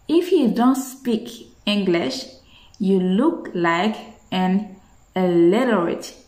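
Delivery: "If you don't speak English, you look like an illiterate" very slow, word by word; slow drill. Shown as a demonstration. Teacher slowly drills the sentence for students. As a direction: spell it out slowly for learners. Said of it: "Comment tu dis".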